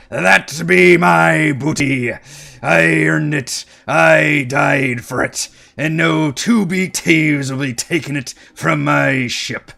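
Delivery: booming, slow pirate captain. Acted. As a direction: roar it like a skeleton pirate. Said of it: "so that he could do a pirate voice".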